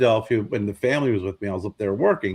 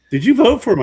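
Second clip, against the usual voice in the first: put-on high, loud kid's question, eyebrows raised; daughter's voice; 10 semitones higher, 8 dB louder than usual. Daughter asks her dad in the elevator, high and loud. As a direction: play the curious young daughter.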